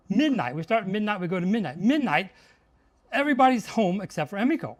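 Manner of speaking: normal talking voice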